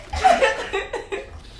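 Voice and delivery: very high tittering laugh; real squealing giggle. Real, not acted. Still tickled by the banter, high giggle. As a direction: let out high leftover giggles.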